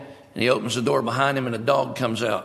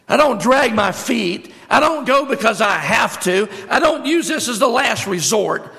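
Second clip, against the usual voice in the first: much higher, louder, slow and ringing; preaching at full force; 9 semitones higher, 6 dB louder than usual. Acted. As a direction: lift the crowd, declaring each line.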